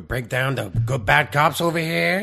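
lightly voiced Al Pacino impression, a touch gruff; a hint of Pacino swagger; mock Al Pacino. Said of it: "don't do the accent there".

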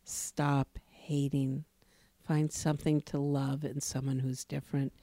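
low, hushed retelling of her message; retold tearful plea. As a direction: quote her softly, with understated emotion. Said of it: "she started to cry".

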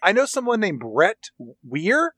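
ordinary delivery

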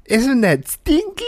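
put-on slow, very high kid voice; high, drawn-out childish question; little daughter. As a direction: imitate a small child proudly asking. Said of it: "she'll tell you to sniff".

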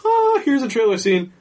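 high-pitched, drawn-out "ah" of discovery; mock amazed recognition. Retelling spotting every trailer shot, high mock amazement. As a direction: act out spotting it with exaggerated wonder.

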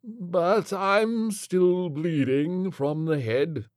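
high, fussy gentleman; dazed prim gent. Acted. Flintlock voiced.

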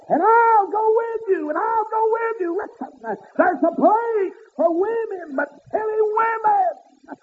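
high, loud and drawn out; slow whooping preaching. Acted. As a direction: stretch every word like a whooping preacher.

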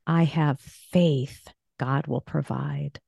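"faith" drawn out, then a pause; slowly rest the weight on "faith"; stress on FAITH. Shown as a demonstration. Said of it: "here's a positive faith affirmation".

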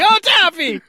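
high, thrilled squeal; excited squeal. Retold or imitated. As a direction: squeal with delight at the taffy. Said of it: "just like".

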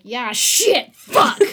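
startled swearing with laugh; loud cursing. Retold or imitated. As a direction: blurt out curses, then laugh. Retelling a scary swerve, cursing.

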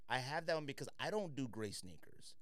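ordinary talk, nothing marked

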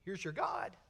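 very soft, barely voiced; hushed whisper. Preacher to congregation, God's faint call, near whisper. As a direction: breathe it like a secret. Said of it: "the whisper may be too faint".

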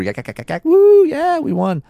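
high-pitched whooping imitation of excited fans; mock victory cheer; wild celebrating. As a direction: build from quick chatter into a high, loud whoop. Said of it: "Heather was going apeshit first moto".

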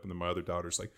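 normal talking voice

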